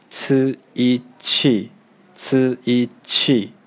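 呲一气 spelled slowly, sound by sound; slow syllable drill. Shown as a demonstration. Teacher spells the last syllable slowly, clearly. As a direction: stretch 呲一气 out for learners. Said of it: "最后一个是".

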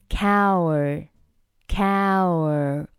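'coward' drawn out as 'cow-er', no final d; built up without final D. Shown as a demonstration. Teacher building the word for learners, slow partial pronunciation. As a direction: stretch 'coward' very slowly, leaving off the d. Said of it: "末尾还有一个的".

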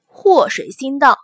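ordinary delivery

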